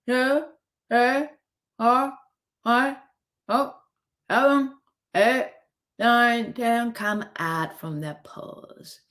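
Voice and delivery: ordinary talk, nothing marked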